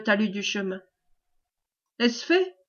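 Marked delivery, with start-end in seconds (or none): 2.0-2.6 called-out question. A narrator's reading. Morgan checks on the hanging, restrained called-out question. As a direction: pitch it up at the end, a restrained cry. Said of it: "cria Morgan".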